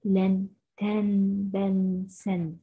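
لن تن بن سن, each drawn out slowly; syllable drill. Shown as a demonstration. Teacher reviewing the tanwin syllables for children, very slowly. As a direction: space out each syllable clearly. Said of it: "إذن".

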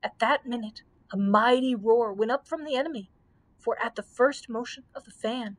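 plain reading voice

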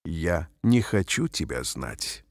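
ordinary talk

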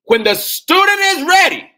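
very high, very loud shout; roaring preacher's climax; full-force preaching shout. Real, not acted. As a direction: cry it out at the peak of fervour. Repeats proverb at peak fervour.

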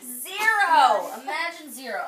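big, loud, high "Zero!"; excited praise. Real, not acted. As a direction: cheer the right answer. Teacher thrilled at right answer.

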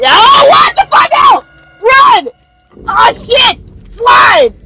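frantic yelling. Real, not acted. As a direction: yell commands in real terror. Frantic chase, yelling commands.

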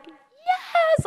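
very high, loud cheer; hammed-up high-pitched victory cry; mock triumph. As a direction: squeal it like a kid who just won. Child self celebrates winning the race.

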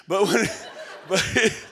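breaking up in laughter mid-sentence; laughing. Real, not acted. Speaker cracking up at Glenn doing the math, laughing. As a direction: laugh through the words.

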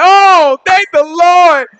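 high, drawn-out thankful cry; celebrating. Acted. Freed people celebrating their escape, jubilant. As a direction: exclaim it in joyful relief. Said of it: "We celebrating like".

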